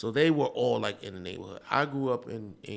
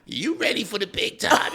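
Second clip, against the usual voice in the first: higher, louder, laughing through it; laughing mock coach asks if you're ready; mock acting coach; 10 semitones higher, 7 dB louder than usual. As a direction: tease like a pushy coach. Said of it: "she was like".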